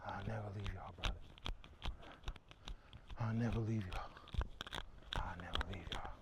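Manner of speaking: low, hushed promise, almost breath only; whispered vow. Real, not acted. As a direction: let the promise sink to a whisper. Vow repeated, fading into whisper.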